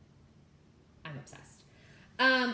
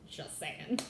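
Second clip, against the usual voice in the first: laughing; 4 semitones lower, 10 dB quieter than usual. Real, not acted. Laughs after teasing husband.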